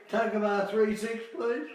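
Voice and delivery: a lightly voiced child calling the exchange; child on the phone. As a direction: play the small boy asking the operator.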